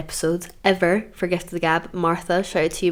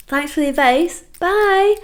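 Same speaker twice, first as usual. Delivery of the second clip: high, sing-song mock thanks; sarcastic sign-off; 10 semitones higher than usual. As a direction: chirp it falsely sweet.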